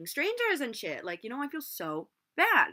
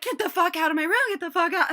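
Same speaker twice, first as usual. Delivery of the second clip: raised, high retold yell; loud, higher-pitched imitation of a screamer; mock screaming; 8 semitones higher, 7 dB louder than usual. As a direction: repeat the scream at partial force. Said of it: "screaming at this poor little girl".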